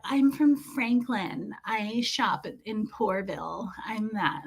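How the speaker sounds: a hint of a stuck-up lady, trailing off; snooty shopper impression. Mimicking the cart-blocking lady to viewers, lightly snooty and fading soft. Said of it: "she was that".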